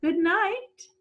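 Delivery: mock motherly goodnight. Guardian says goodnight to monster.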